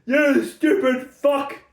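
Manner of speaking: gleeful sneering taunt, the stupid fuck line slowed; mocking taunt. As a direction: laugh at them like a cruel twist ending. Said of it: "and then just goes".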